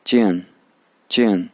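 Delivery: चियन with a sharp falling fourth tone; Chinese tone demo. Shown as a demonstration. Teacher demonstrating the fourth tone for students, sharp fall. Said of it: "गौर से आप सुने".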